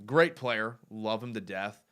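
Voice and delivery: ordinary delivery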